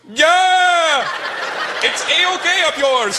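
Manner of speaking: put-on very high, loud, stretched Indian-accented shout; hammed-up botched insult in an Indian accent; dad's accented yell. Dad screws up an insult, accented triumphant yell. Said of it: "My dad screws it up one day".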